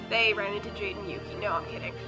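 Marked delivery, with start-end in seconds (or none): none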